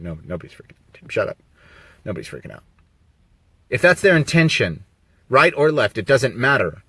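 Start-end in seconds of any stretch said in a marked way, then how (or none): 0.0-2.6 much softer, fast throwaway; quick, much quieter dismissal; muttered aside. Real, not acted. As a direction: brush it off under your breath. Aside to viewers, self-correcting.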